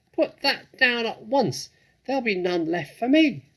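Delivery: very high, loud shriek; shrieking rat. A narrator's reading. Rat shrieks at the fox to go away. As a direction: screech it like a furious rat. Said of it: "shrieked Rat".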